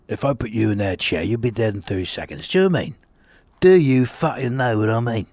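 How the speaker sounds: gruff Cockney hard man threat; Jason Statham. Retold or imitated. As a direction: menace him like a London thug. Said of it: "I imagined a scene".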